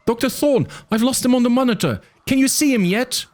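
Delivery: put-on very high urgent boy voice; kid on radio. A narrator's reading. Arby voice over radio.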